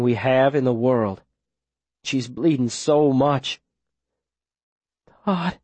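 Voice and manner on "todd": low, slurred and weak; fading, slurring. Acted. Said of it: "her voice low and slurring".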